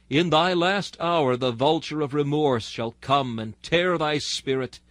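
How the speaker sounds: usual speaking voice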